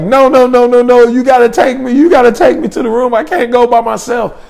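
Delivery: high-pitched panicked pleading; freaking out. Retold or imitated. Girl begging to be taken to the room, freaking out. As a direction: beg frantically, imitating a girl in a panic. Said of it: "She starts freaking out".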